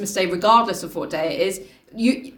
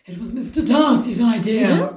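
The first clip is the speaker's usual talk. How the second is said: slow, rasped character line; raspy; slower than usual. Acted. Father explains the surprise visit, rasping. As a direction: rasp it out, unhurried. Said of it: "rasped Mister Bennett".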